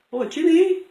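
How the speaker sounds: put-on mother calling in a high Irish accent. Irish mother calls her son across the street, Irish accent. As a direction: call the boy's name in an Irish mother's lilt. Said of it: "my mother's Irish accent".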